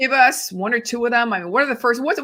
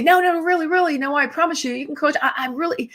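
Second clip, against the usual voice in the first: a lightly voiced client, higher, insisting they're coachable; mocking eager client; 6 semitones higher than usual. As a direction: play the over-eager client, mildly mocked. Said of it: "And people say".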